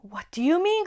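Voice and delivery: put-on a girl crying out in shock; cried out. A narrator's reading. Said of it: "cried Lucy".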